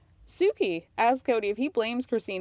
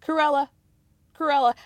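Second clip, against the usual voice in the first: mild mock yell; 4 semitones higher, 5 dB louder than usual. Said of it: "Christine yells out".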